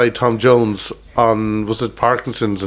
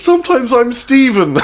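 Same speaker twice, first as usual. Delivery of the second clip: high, slow, laughing through the words; laughing retelling of a punchline; laughing quote; 11 semitones higher, slower than usual. Retold or imitated. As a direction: crack up while quoting him. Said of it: "he goes".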